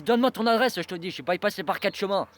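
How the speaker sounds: loud, high threatening yell; menacing shout. Retold or imitated. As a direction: throw the demand at him as a threat. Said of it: "sur un ton menaçant".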